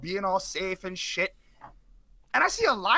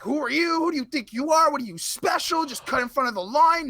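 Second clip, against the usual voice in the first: hammed-up indignant old woman; imitating an angry old woman; 5 semitones higher than usual. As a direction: play the outraged older lady cutting you down. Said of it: "blasting me at the A T M".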